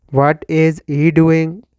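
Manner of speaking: ordinary talk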